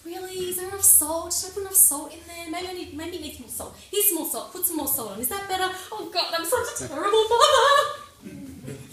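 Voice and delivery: high, fretting mother voice rising to a wail; anxious mum spiralling about the salt; fussing mum. Retold or imitated. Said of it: "And she'd go".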